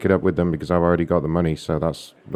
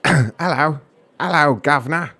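slow, higher cockney hello governor; 7 semitones higher, slower than usual. Pretend. Prankster testing his London accent on hold, hammed-up cockney. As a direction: ham up an old London accent. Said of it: "My accent's gone all over".

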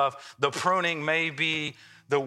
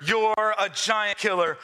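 ordinary talk

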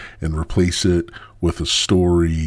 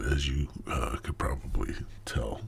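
whisper; 4 semitones lower, 13 dB quieter than usual. Real, not acted.

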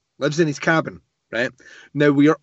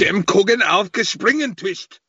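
mock German accent; 6 semitones higher than usual. Co-host riffing on the German-sounding devil, mock German. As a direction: fake German gibberish, hammed up. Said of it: "sounded like a German".

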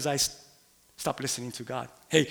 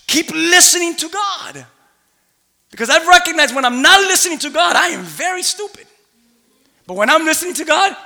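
loud, higher preaching cry; preaching at full force; 10 semitones higher, 9 dB louder than usual. Real, not acted. Preacher urges the church to keep listening, loud and fervent. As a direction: fire up the congregation.

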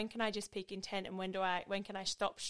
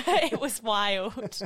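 louder, slow, laughing through it; 3 semitones higher, 10 dB louder, much slower than usual. Real, not acted. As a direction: laugh out the memory. Laughing recalling mayhem trip.

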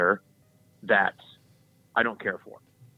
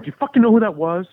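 loud, higher excited burst; 8 semitones higher, 8 dB louder than usual. Retold or imitated. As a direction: blurt it out thrilled. Excited after meeting Cam Newton.

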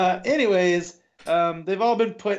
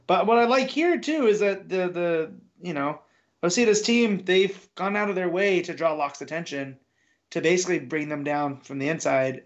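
normal talking voice

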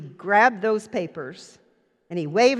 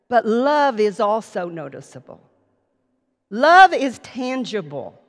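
emphatic love, slowed and louder; stress on love; 6 dB louder than usual. Acted. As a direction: make Love tangible with weight.

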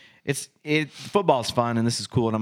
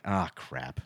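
low, softer muttered realization; quiet dismay; 8 semitones lower, 6 dB quieter than usual. Retold or imitated. Coach realizing what he turned into, quietly dismayed. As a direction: sink into subdued self-reproach, as retold.

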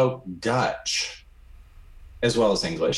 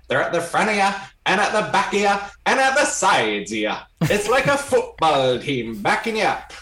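Irish brogue; 5 semitones higher than usual. Retold or imitated. Retelling the Irish spirit's words, a light higher Irish brogue. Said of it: "comes through with an Irish brogue".

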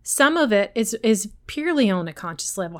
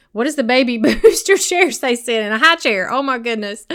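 laughing through a mental blank; real chuckle breaking into louder, higher words; amused at herself; 3 semitones higher, 6 dB louder than usual. Real, not acted. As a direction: laugh at your own forgetfulness. Laughs at forgetting the word.

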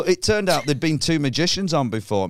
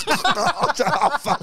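sudden high yelp of alarm; as the chair goes, high startled panic; shocked oh fuck; 12 semitones higher than usual. Retold or imitated. Hanging comic realising the chair is gone, high shocked yelp.

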